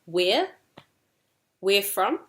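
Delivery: WHERE asked with rising intonation; posing a question. Shown as a demonstration. Teacher models the two questions for learners, WHERE rising. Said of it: "Posing the question".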